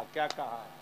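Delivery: usual speaking voice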